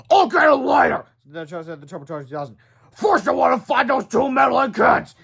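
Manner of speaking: high, loud monster. A narrator's reading. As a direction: growl out orders like a beast. Turbo Toad voiced.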